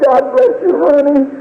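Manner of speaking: very high, tender grieving voice; tearful farewell. Retold or imitated. Father blessing his dying daughter, tearful. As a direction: bless the child through grief.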